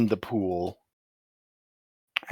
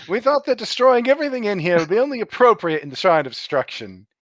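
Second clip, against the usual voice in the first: higher, louder, brisk and pleased with himself; haughty NPC voice; 9 semitones higher, 4 dB louder, faster than usual. Acted. GM voicing NPC explaining destruction, higher pompous voice.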